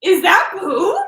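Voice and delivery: high, loud incredulous question; mock disbelief. Acting out brother spotting the smear, mock disbelief. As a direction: squeal it as the startled brother. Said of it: "then he's like".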